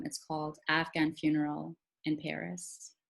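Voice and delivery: usual speaking voice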